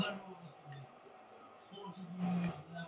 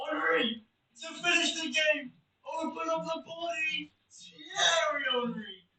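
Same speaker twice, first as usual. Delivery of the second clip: commentator shout; 9 semitones higher, 3 dB louder, slower than usual. Acted. Calling a goal like a commentator.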